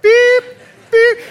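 high, loud reversing beep; piercing mock warning beeps; imitated beep. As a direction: beep like a vehicle backing up.

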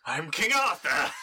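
slow, grand mock-heroic proclamation; mock King Arthur. Player pulling a sword from a statue, hamming up a heroic king. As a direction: declaim it like a hammed-up legendary king.

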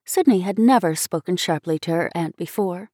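ordinary delivery, nothing marked

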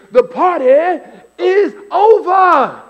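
high, loud preacher voice with "is over" stretched out slow; preacher's punchline. Retold or imitated. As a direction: land "over" like the sermon's big line. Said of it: "the whole point he kept saying is".

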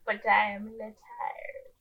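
soft, slow mock French quote; bad French accent. Host repeating a favorite dumb line, bad French accent. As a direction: drawl it quietly in amateur French accent. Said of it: "I say that dumb line".

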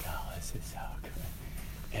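low, slow whispered aside; imitating a hushed, absorbed playgoer; hushed audience whisper. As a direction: whisper it to yourself in a theatre seat. Delighted whisper from theatre seat.

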